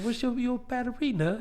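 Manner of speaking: put-on high little-kid British accent. Shown as a demonstration. Boy asking mom about outfit, British accent. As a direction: ask like a small, innocent British child. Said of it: "with a British accent".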